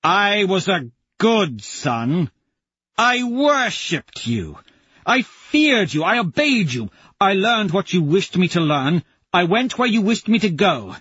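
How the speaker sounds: a wounded son's accusation building; resentful jackal tirade. Acted.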